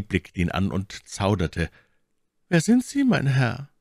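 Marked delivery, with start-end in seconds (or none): 2.5-3.7 hoch gesprochene Mylady, misstrauisch. A narrator's reading. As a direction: als misstrauische Lady, deutlich höher. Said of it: "fragte sie". (Mylady fragt den Offizier misstrauisch, hohe Stimme)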